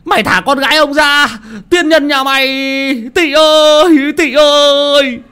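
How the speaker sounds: high, desperate father screaming for his daughter; frantic father yelling. Acted. Father shouting at a stranger drowning his daughter, high and desperate.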